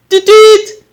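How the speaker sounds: sifflet aigu et fort imité à la voix; coup de sifflet imité. A narrator's reading. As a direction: siffle fort comme un surveillant. Sifflet du surveillant. (sifflet imité, aigu et fort)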